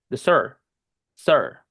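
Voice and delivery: "sir" with one English r-coloured vowel; E dropped. Shown as a demonstration. Teacher contrasting Spanish ser with English sir, E dropped. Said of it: "como si no tuviera la E".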